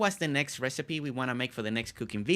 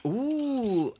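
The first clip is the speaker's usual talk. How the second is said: excited ooh; 11 semitones higher, 3 dB louder than usual. Real, not acted. Craving the salami pizza.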